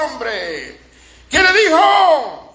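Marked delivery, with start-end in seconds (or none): 1.3-2.4 high, drawn-out question; high insistent call for an answer; demanding preacher's shout. Real, not acted.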